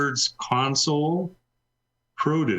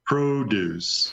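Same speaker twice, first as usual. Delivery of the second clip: "produce" with PRO- leaned on; deliberate "produce", weight on the first syllable; stress on first syllable. Shown as a demonstration. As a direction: say "produce" as the noun, PRO-duce. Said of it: "Take the first syllable".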